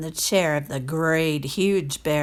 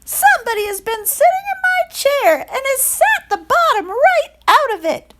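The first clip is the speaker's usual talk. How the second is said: much higher, louder tiny bear voice; little wee bear; 7 dB louder than usual. A narrator's reading. Wee bear finds his porridge eaten, tiny high voice. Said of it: "in his little small wee voice".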